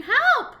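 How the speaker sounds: high loud scream of help. A narrator's reading. Boy trapped by witches, screaming for help. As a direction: shriek it out in terror. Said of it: "I began to scream".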